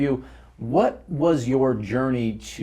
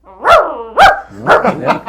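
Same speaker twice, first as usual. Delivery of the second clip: playful bark; 12 semitones higher, 9 dB louder than usual. Shown as a demonstration. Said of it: "How about an evil bark?".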